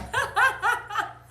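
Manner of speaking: high, loud burst of laughter; laughing. Real, not acted. Singer forgetting the first line, loud high laugh. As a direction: crack up at your own singing.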